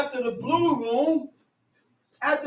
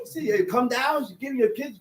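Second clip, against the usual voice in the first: ordinary talk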